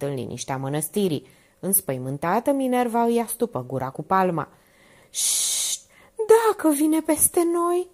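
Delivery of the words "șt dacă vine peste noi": unvoiced shh, then high slow dread; frightened hush. A narrator's reading. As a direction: shush then high slow fearful whisper-like plea. Minerva hushes, fearing the killer.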